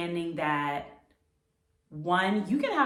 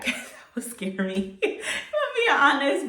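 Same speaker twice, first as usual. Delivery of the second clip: higher, louder, laughing through the words; laughing admission; 8 semitones higher, 5 dB louder than usual. Real, not acted. Speaker admits seeing God would scare her, laughing. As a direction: break into real laughter while confessing.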